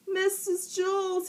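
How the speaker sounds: put-on high boyish call to the teacher. A narrator's reading. Boy stuck to his chair, calling teacher. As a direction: call her name up high across the room. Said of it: "he called".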